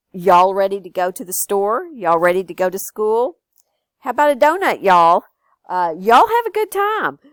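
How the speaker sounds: loud, friendly Texan y'all phrases; Texan drawl. Shown as a demonstration. Grandma showing the kids how a Texan talks, loud Texan drawl. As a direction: call out y'all like a Texas local. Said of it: "teach you to speak like a Texan".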